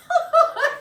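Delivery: loud, high roar of laughter. Acted. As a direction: burst out laughing hard. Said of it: "He roared".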